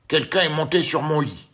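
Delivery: loud booming papa bear; big gruff Papa Bear voice. A narrator's reading. Papa Bear growls at the empty chair, loud and big. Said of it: "dit Papa Ours d'une très grosse voix".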